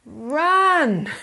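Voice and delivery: loud, high, drawn-out order; urgent command. Acted. Adviser to someone feeling anger rising, urgent shout. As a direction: call it out as an escape order. Urgent advice to flee temper.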